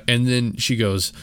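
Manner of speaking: ordinary talk, nothing marked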